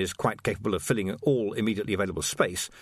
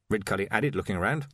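plain reading voice

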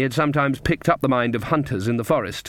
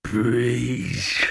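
drawn-out growled plea; strained animal voice, begging; wolf's growl. A narrator's reading. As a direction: growl it like a wolf trying to talk. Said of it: "it growled".

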